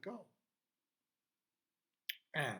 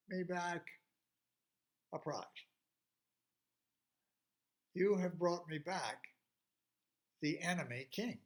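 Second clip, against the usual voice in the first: father's voice; 3 semitones higher, 6 dB louder than usual. Acted. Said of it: "then her father's voice".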